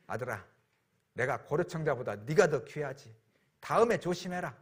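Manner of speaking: a hint of a tender father; gentle forgiving father. Father softly forgiving his son who broke the vase, tender.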